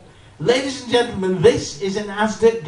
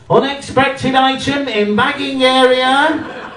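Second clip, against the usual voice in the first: louder, slow robotic announcement; loud machine alarm voice; 4 semitones higher, 6 dB louder, slower than usual. Retold or imitated. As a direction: declare it like an alarm going off. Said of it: "the machine goes".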